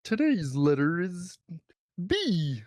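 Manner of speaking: high, slow frog puppet voice; Kermit the Frog voice. Pretend. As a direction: squeeze it up into a cartoon frog. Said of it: "My wife says Kermit the Frog voice".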